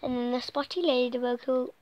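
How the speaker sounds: ordinary delivery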